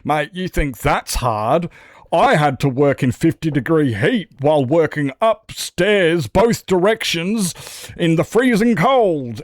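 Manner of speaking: mock tough-guy brag, slow, high and loud; mocking one-upper. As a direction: ham up the guy who always had it worse. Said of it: "I don't like hearing the whole".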